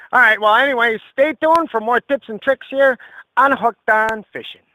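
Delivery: loud, hearty fishing-show host; folksy TV host. Acted. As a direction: sell it like a local TV fishing host.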